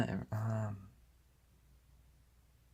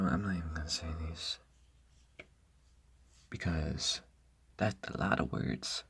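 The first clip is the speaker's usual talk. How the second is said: very soft, almost voiceless mumble; hushed note to oneself; quiet aside; 3 semitones lower, 12 dB quieter than usual. Real, not acted. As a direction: say it under your breath. Muttered aside, then performed take.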